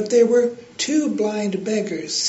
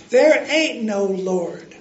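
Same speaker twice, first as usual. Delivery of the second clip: slow, gruff atheist retort; angry shout; 4 dB louder, slower than usual. A narrator's reading. Angry atheist neighbor shouting back, a hint of gruff voice. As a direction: throw it back over the fence, slowly. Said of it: "he would shout".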